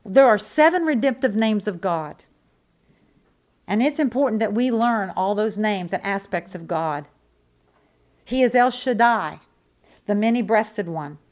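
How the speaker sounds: ordinary talk, nothing marked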